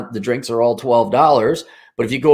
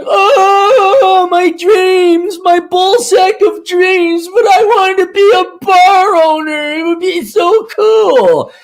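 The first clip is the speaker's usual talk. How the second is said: much higher, louder, slow whining lament; whiny wail; 7 dB louder, much slower than usual. Pretend. Kicked would-be bar owner wailing over his lost dream.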